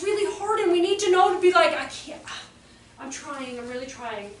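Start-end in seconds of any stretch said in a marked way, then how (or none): 1.7-4.3 softer, strained admission; mild acted overwhelm. Teacher near the limit, quietly pleading for understanding. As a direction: admit it quietly, as if at your limit.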